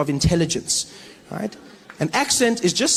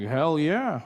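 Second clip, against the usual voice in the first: put-on American accent; 3 dB quieter than usual. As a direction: assure him in an American accent. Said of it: "I met an American".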